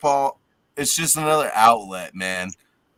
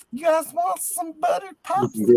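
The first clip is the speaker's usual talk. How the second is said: put-on old man from Family Guy. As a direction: shout it like a creepy old man. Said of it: "sound like the old man from Family Guy".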